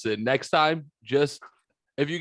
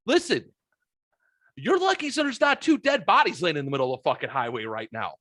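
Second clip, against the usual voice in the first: high, heated put-on voice of a fed-up driver; indignant retort; 7 semitones higher than usual. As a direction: vent at the bystander as if you were there. Voicing an imagined indignant retort.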